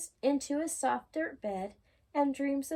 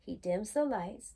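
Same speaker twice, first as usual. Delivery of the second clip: plain reading voice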